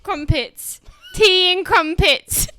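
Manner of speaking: loud, exaggerated British; British accent. Shown as a demonstration. Guest answering as a Brit, loud British accent. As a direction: announce it in a big put-on British voice. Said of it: "what do you eat if you're British?".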